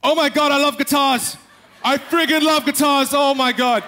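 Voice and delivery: a voice bursting with passion while the body stays still; over-the-top passion. Shown as a demonstration. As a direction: gush about guitars like a maniac. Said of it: "I was really passionate, my voice".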